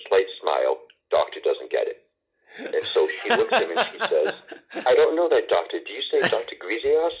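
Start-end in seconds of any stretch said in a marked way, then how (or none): 2.6-7.2 laughing. Real, not acted. Laughing while quoting the surgeon.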